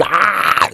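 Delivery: high, loud cry of sudden pain. Retold or imitated. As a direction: cry out as the back strikes. Sharp back pain on the toilet.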